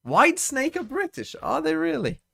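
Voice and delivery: shocked high exclamation; surprised. Real, not acted. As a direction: squeal it in surprise. Surprised quiz reveal.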